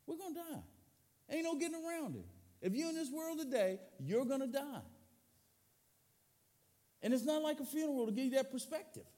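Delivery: high, pressing preacher voice; urgent preaching. Acted. Pastor warning the church that everyone dies, urgent. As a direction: drive the point home, pitched high.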